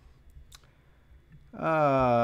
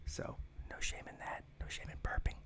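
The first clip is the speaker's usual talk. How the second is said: whispering; 17 dB quieter than usual. Real, not acted. After burping, embarrassed.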